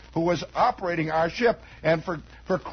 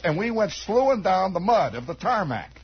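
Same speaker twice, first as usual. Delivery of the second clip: normal talking voice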